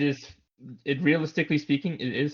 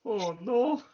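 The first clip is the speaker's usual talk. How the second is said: put-on Canadian accent; 9 semitones higher than usual. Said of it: "To finish in that one".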